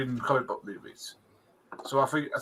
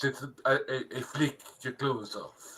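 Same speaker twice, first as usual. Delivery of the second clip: put-on Russell Crowe accent; 4 dB quieter than usual. Co-host making fun of an actor's film line, mock Greek-Italian accent. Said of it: "Russell Crowe's accent".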